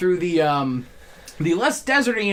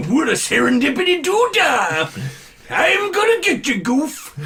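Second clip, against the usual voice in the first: high, loud cartoon Pete voice; Pete impression; 9 semitones higher, 3 dB louder than usual. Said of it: "a very good impression of Jim Cummings as the Disney character Pete".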